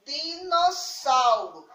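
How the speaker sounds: DINOSSAURO read out slowly, drawn out syllable by syllable; slow careful reading. Shown as a demonstration. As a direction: sound out "Dinossauro" slowly for young readers. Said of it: "Vamos tentar ler".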